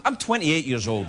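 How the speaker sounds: ordinary talk, nothing marked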